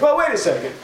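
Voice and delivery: high, sudden wait-a-second; startled objection. Retold or imitated. Pharisees interrupting the trial, startled high objection. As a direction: stop everything, sudden.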